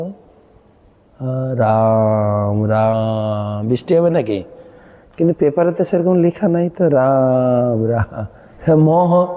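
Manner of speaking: drawn-out "রাম রাম" between idle chatter; mock distracted devotee. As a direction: imitate a chanter gossiping about weather. Mocking a distracted chanter. (অন্যমনস্ক ভক্তের ব্যঙ্গ নকল)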